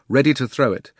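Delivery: ordinary delivery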